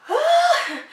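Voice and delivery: sharp gasp then a high loud cry of shock; a shocked scream from seeing it in the mirror; startled gasp. Retold or imitated. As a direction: gasp and yelp in sudden fright. Sees him vomit in mirror.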